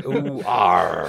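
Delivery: mock pirate growl; pirate cry. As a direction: answer like a gruff buccaneer. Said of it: "a bad-sounding pirate".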